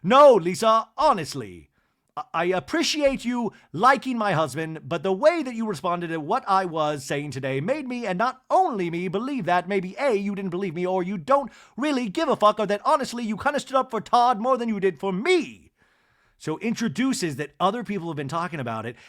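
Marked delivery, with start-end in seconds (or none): 0.0-1.7 loud, high opening then dropping; heated retort. Retold or imitated. Acted dinner quarrel retort. 2.2-15.7 raised, higher voice, pressing the grievance; louder retelling of an aggrieved speech; heated confrontation. Retold or imitated. Acted confrontation at dinner.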